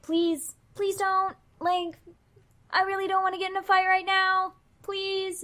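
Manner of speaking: high, anxious pleading; pleading to calm. Acted. Cecil calming his angry partner, high pleading. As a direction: beg her to back off, voice pitched right up. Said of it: "trying to calm her down".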